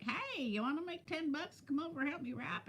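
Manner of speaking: put-on coaxing a kid with ten bucks, high and soft; bright high offer. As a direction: sweetly pitch the deal up high. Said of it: "I'm like".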